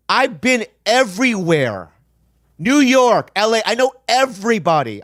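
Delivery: exasperated, indignant outburst; real frustration. Real, not acted. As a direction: vent your anger at the gatekeepers, voice rising. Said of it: "It makes me so angry".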